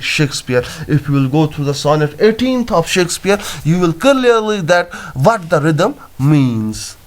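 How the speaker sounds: usual speaking voice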